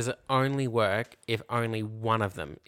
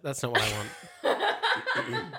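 laughing protest; 4 semitones higher, much slower than usual. Real, not acted.

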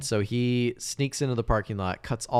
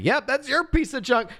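high, loud gloating imitation; hammed-up taunt tipping into laughter; mocking gloat; 4 dB louder than usual. Mocking biker reveals the swapped bike.

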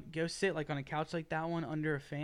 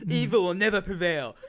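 mock hero catchphrase; 6 dB louder than usual. Hero catchphrase, then repeated.

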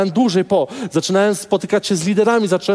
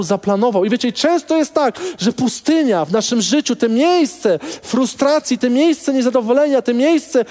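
higher, faster, driving the point home; fired-up preaching; 6 semitones higher than usual. Acted. Preacher urging the church about the desert season, fired-up. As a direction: preach it out with rising urgency. Preacher rises to full force.

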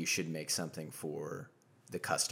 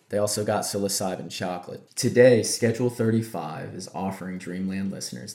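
ad read; 2 semitones higher, 8 dB louder than usual. Acted. Host reads sponsor ad.

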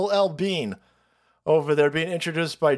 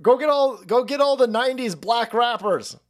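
panicked staffers; 6 semitones higher than usual. Retold or imitated.

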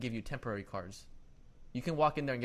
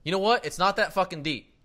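loud, higher, angry retort; retold pissed-off outburst; 6 semitones higher, 11 dB louder than usual. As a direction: re-enact snapping at the clerk, fed up. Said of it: "I got pissed off".

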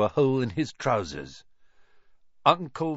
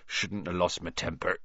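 said ruefully; 7 semitones lower, 7 dB quieter than usual. A narrator's reading. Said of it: "he said ruefully".

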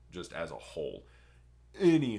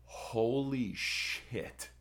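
very slow, drawn-out awe; slow amazed mock gasp of words; stunned roommate; 4 semitones higher, much slower than usual. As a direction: let it hang in disbelief. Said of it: "And he's like".

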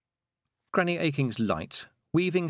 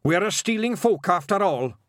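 higher, louder Scots brogue; Scottish wee man; 8 semitones higher, 5 dB louder than usual. A narrator's reading. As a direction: bold little Scottish warrior. William in Scots voice.

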